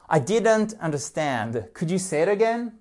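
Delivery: ordinary delivery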